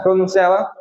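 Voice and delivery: usual speaking voice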